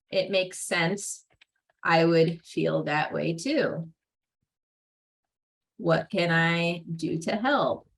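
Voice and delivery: put-on slow, gentle, reassuring words to an upset child; calm acknowledgment. Shown as a demonstration. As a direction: soothe them and offer help. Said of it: "you acknowledge by acknowledging".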